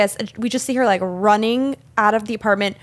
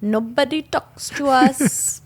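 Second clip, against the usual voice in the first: slow, drawn-out pretend misery; mock lament; 4 semitones higher, slower than usual. Podcast host jokes about being cut off from everyone, mock lament. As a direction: wail it slowly as a joke, higher than usual.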